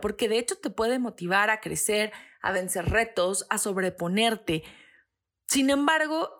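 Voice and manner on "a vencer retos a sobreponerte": normal talking voice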